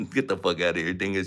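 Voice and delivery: ordinary delivery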